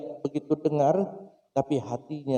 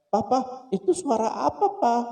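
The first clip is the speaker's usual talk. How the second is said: put-on child's question; 9 semitones higher than usual. Small child asking father.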